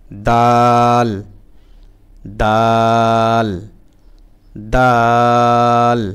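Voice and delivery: দাল held very slow and loud; teacher drilling the letter dal; drilled dal. Shown as a demonstration. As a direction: belt out দাল long and clear. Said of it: "Bangla দর মতো".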